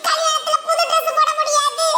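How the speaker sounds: put-on high, slow childish whine about the wedding; little girl's voice. Little girl tells her father, in a high sulky child voice. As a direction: sulk like a small child telling tales.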